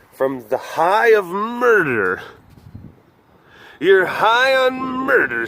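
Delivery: slow playful tease at the cat; mock teasing. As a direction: tease her, drawing it out.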